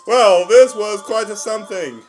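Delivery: high, loud exclamation; exclaimed verdict. Real, not acted. Reviewer sums up after a stunned pause, high and loud.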